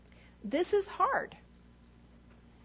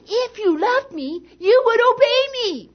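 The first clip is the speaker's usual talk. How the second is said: harsh, much higher and louder mock scolding; mean tone; 10 semitones higher, 13 dB louder than usual. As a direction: snap it like a mean parent quoting scripture. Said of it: "they're quoting scripture in a really mean tone".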